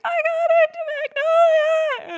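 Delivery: very high, loud ecstatic scream. Retold or imitated. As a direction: scream the news at the top of your lungs. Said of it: "screaming, screaming, screaming".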